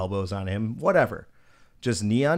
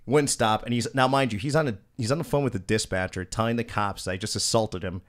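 ordinary delivery

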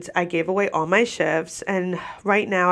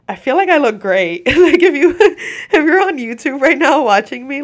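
giggling through the words; laughing; 7 semitones higher, 8 dB louder than usual. Real, not acted. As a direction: laugh while bragging. Laughing about her look.

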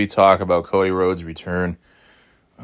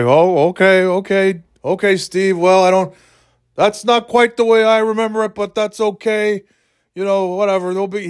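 much higher-pitched placating voice; Jim Duggan, defusing; 9 semitones higher than usual. Acted. Sweetest guy on the planet placating a bully, defusing. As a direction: play a sweet guy giving way. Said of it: "diffusing it".

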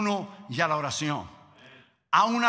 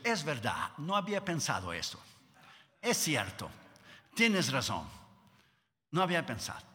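soft conceding God; 6 semitones lower, 7 dB quieter than usual. Retold or imitated.

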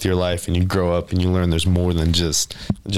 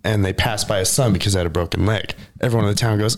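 ordinary delivery

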